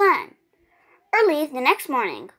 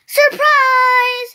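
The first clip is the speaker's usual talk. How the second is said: loud, much higher "Surprise!"; shout; 10 semitones higher, 8 dB louder than usual. A narrator's reading. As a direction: burst out from hiding. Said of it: "shouted everyone".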